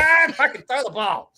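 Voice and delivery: high, loud, strained self-mockery; mock yell at himself. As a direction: yell it high like a frantic teammate. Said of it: "I'm like".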